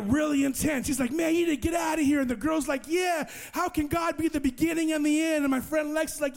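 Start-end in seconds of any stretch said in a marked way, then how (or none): 1.1-2.2 fast, high, shouted order; angry shout. Retold or imitated. Jesus shouts at Marco to leave, angry and fast. 2.9-5.4 mocking challenge. Girl mocks his answer.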